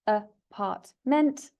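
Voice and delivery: "apartment" broken into three slow beats; very slow, separated syllables in "apartment". Shown as a demonstration. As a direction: count out "apartment" as a, part, ment. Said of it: "It has three syllables".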